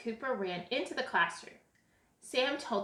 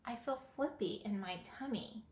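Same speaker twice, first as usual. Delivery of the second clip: softer, small admission; 8 dB quieter than usual. A narrator's reading. Sam telling dad about a flippy tummy, soft. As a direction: confide it softly. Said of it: "Sam said softly".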